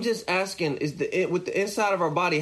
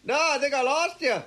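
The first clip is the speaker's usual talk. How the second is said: higher, as if on a bad line; bad connection call; 9 semitones higher than usual. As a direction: pretend the call is dropping. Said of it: "You pretend you're frozen?".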